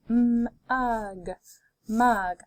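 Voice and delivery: d, ot, dot, parts pulled apart then joined; D, ot, dot: each part separate, then the whole word; segmented then blended. Shown as a demonstration. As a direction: sound it out and blend it. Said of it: "Let's keep going".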